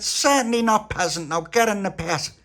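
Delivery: high, loud, imperious refusal; haughty queen. Acted. Queen refusing Tony, imperious and loud. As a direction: slap him down grandly.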